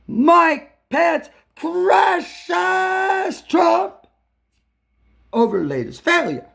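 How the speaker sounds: big, slow announcer-style headline read; mock headline read. Reading the scrambled dice headline, hammed-up announcer read. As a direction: read it like dramatic breaking news.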